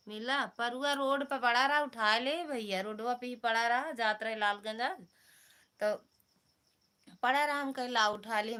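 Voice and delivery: loud, eager retelling; loud telling. Real, not acted. Telling viewers where the glasses were found, loud and eager. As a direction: tell it loudly like big news.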